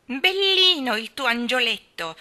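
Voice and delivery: slow sarcastic taunt, loud; sneering. A narrator's reading. Colin mocks Mary's friend, sneering. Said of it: "sogghignò Colin".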